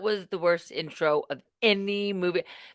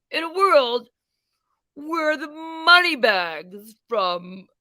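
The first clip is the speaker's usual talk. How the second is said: slow, booming trailer narrator; movie trailer voice; 4 semitones higher, slower than usual. Pretend. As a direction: epic voiceover announcer. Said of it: "I was kinda hoping for".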